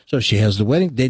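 usual speaking voice

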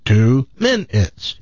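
stilted automated phone voice; robotic. Retold or imitated. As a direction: break it up word by word like a recording. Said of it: "it's a robotic thing".

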